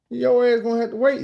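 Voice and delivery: high insistent call through the door; mock yell. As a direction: tell her to wait, loudly. Yelling through bathroom door.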